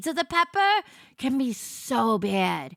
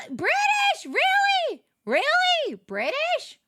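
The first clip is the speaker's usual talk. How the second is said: very high, incredulous repeats; mock-shocked high "Really?"; high disbelief; slower than usual. Real, not acted. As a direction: squeal your disbelief at the comment. Reacting to British comment.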